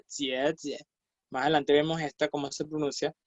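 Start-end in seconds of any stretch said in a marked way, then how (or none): 0.1-0.8 "jie jie" softly, with a ch-like j; J sound demo. Shown as a demonstration. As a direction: repeat "jie jie" softer, each j near "ch". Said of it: "Así se pronuncia la J".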